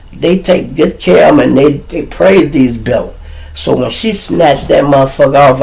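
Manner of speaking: usual speaking voice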